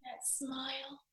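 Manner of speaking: high, soft voice through tears; real choked-up tenderness; tearful. Real, not acted. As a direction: talk while crying a little. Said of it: "Sorry for crying a little bit".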